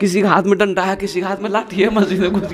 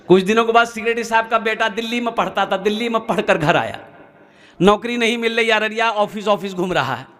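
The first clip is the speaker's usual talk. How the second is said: ordinary delivery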